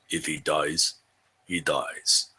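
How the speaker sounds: put-on low, soft Russian accent, flat and cold; deadpan Russian brute in a low murmur; Drago impression. Quoting Drago to the players, low Russian accent. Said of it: "Drago from Rocky Four".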